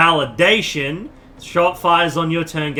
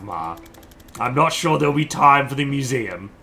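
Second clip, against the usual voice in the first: normal talking voice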